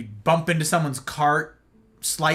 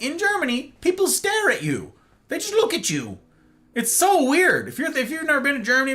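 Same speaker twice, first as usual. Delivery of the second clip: much higher, amazed disbelief; incredulous; 10 semitones higher than usual. Real, not acted. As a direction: exclaim how strange it is. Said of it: "incredulous rant".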